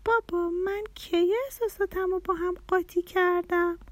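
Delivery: put-on high-pitched puzzled child; small child's baffled question; confused. A narrator's reading. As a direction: ask dad like a bewildered little girl. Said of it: "پرناز که کمی گیج شده بود گفت".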